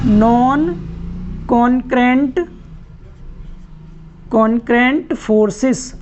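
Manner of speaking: each word set apart and stressed; drilled term. Shown as a demonstration. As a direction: say "नॉन concurrent forces" word by word for students to repeat. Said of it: "क्या बोलते हैं उसको?".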